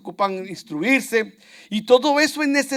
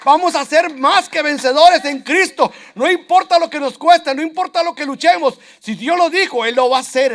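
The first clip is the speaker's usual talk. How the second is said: loud, high, rousing declaration; bold faith; 6 semitones higher, 8 dB louder than usual. Acted. Caleb declaring faith to the people, loud and rousing. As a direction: proclaim it like a fearless believer. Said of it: "Él decía".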